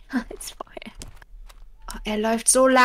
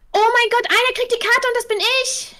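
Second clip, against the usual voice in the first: excited squeal; 11 semitones higher, 4 dB louder than usual. Real, not acted. Excited to get the map.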